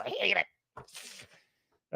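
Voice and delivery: softly spat hate; muttered. Real, not acted. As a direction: drag it out under your breath. Hating Disney Star Wars.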